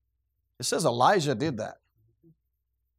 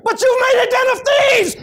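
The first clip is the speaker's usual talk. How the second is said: furious shout; 10 dB louder than usual. Retold or imitated. Jesus drives out money changers, shouting in fury. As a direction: thunder it like a man clearing the temple. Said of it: "He's running around screaming".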